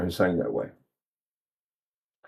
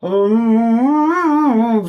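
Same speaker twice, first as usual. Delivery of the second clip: high, open hummed tone; high loose hum; loose sung hum; 6 semitones higher than usual. Shown as a demonstration. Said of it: "they did sing like this".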